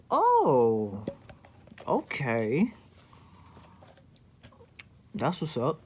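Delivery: drawn-out, offended oh okay; sarcastic okay. Retold or imitated. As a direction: let the oh hang, half-stung. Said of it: "And I was like".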